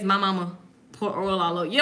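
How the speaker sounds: ordinary delivery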